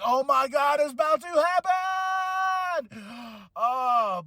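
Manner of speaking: high, drawn-out cry building in pitch; mock alarmed shout. Acted group reaction, building dread.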